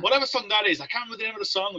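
ordinary delivery

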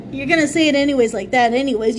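ordinary talk

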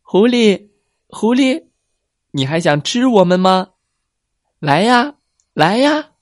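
high, loud playful taunt; teasing. Acted. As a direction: taunt the fox from the water. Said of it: "一边笑一边说".

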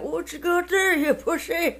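put-on high, wavering old person voice. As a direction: play a cranky old grandparent. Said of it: "I'd be making an old man voice".

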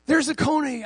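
high, excited tour guide pointing out; lightly voiced guide spotting conies. Tour guide points out a coney, high and excited.

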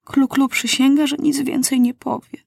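put-on a worried girl warning her king; Klu-Klu voice. A narrator's reading.

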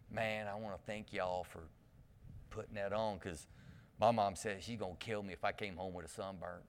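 low, soft laid-back drawl; easygoing murmur, no hurry; Matthew McConaughey drawl. Retold or imitated. Pastor imitating the kid thanking them for sunscreen. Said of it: "but he talked like this".

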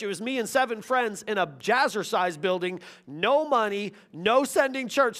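ordinary talk, nothing marked

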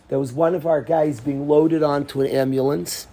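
ordinary talk, nothing marked

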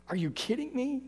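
soft, weary, head-shaking disbelief; half to himself, quietly exasperated; muttered disbelief. Retold or imitated. Jesus shaking his head at the disciples, quietly.

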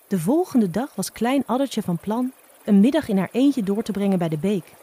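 usual speaking voice

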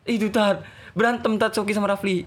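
acted whimpering urgency; imitated tearful report. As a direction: mimic an upset student blurting it out. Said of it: "nangis sesek tuh".

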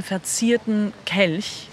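ordinary delivery, nothing marked

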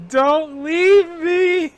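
high, loud, very slow begging wail; wailing plea. Retold or imitated. Crying girl begs the other not to leave, high slow wail. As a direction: drag out the plea like sobbing.

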